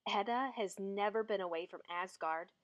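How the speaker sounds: plain reading voice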